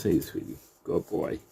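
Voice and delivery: quiet, cooing praise; calm the rabbit with soft praise; gentle murmured sweet talk to a pet. Real, not acted. Soothing rabbit.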